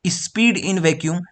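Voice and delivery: "Speed in vacuum" said very slowly, each word apart; slow dictation. Shown as a demonstration. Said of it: "तो आप लोग क्या बोलोगे?".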